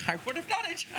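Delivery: high-pitched little objection; mock protest. Game master as Fennel, just released by Brannick, protesting.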